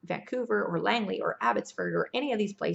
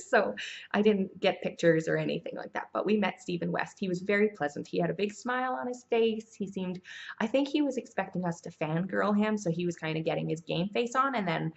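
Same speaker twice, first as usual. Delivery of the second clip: ordinary talk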